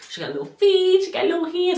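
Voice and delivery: high, tender coo over little feet. Real, not acted. Describing tiny feet on the scan, high cooing. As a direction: coo like to a newborn.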